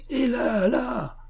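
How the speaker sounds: high, eager call to get attention; a sudden high shout over the noise. A narrator's reading. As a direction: pitch it way up and call across the crowd. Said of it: "cria monsieur de Chaudfontaine".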